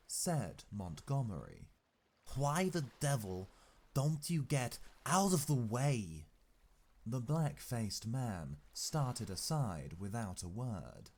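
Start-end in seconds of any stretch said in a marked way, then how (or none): 2.3-6.3 louder, higher, exasperated outburst; irritated, forceful character voice; angry demand. A narrator's reading. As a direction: demand that he clear off, raised voice. Angry character orders man aside.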